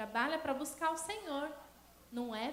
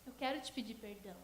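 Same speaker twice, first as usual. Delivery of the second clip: quietly retold plea; 4 semitones lower, 8 dB quieter than usual. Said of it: "ela falou bem assim".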